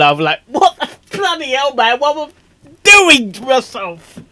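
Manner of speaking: usual speaking voice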